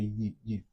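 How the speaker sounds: softly repeated "yi", y glide into ee; sound of letter Y. Shown as a demonstration. Teacher giving the English Y sound, soft "yi". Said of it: "Le son de Y en anglais".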